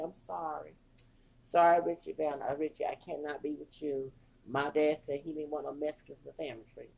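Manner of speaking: small, sorry, barely there; meek apology. Retold or imitated. Donna apologizes to boyfriend Richard.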